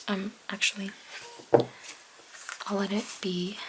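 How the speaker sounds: usual speaking voice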